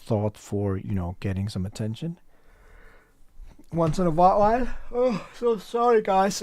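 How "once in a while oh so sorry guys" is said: talking through a yawn; words stretched by a yawn. Real, not acted. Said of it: "so I just keep yawning".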